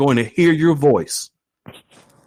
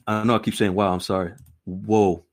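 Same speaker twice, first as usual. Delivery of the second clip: ordinary delivery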